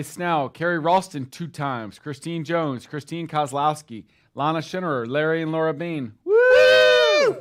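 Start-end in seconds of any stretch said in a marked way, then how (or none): 6.2-7.4 high, loud cheer; cheering woo. Real, not acted. Host whooping for the team. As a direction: whoop for the absent agent. Cheering for absent Larry and Laura.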